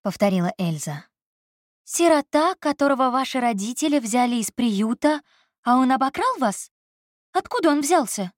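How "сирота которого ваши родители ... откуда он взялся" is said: higher woman's voice, worried and quick; Elsa, alarmed. A narrator's reading. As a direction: voice Elsa as a startled young woman. Elsa's alarmed questions.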